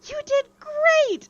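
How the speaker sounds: excited cheer. Acted. Douglas cheering for the player who won. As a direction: cheer like a thrilled kid.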